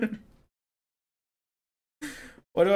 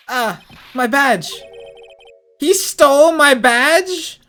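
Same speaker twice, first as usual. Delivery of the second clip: slow, much higher, loud disbelief; panicked shout; 12 semitones higher, 10 dB louder, much slower than usual. Acted. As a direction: yell in outrage at the thief. Monkey steals the badge.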